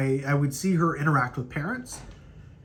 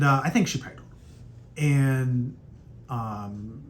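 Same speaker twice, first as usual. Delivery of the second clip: ordinary delivery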